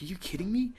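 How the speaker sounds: breathless whispered disbelief; a hushed, amazed aside; stunned whisper. Retold or imitated. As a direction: whisper it like you can't believe the news. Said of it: "I was like".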